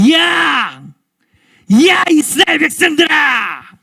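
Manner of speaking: loud, high battlefield shout; taunting giant calling out the enemy; menacing Goliath. Acted. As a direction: bellow a threat at an enemy army. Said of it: "이스라엘의 군대를 위협한 거죠".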